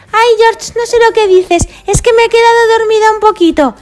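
sleepy Peppa voice. Acted. Peppa waking up in car.

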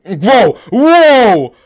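high, loud shocked whoa; alarmed yelling. Real, not acted. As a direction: yell in real alarm. Alarmed at chat image.

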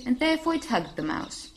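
plain reading voice

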